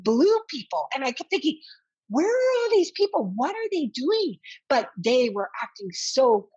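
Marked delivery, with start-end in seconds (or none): 2.1-4.4 high, startled inner questions; bewildered wonder. Retold or imitated. Patient wheeled into the operating room, astonished. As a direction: gasp at the crowd of surgeons. Overwhelmed by crowd of surgeons.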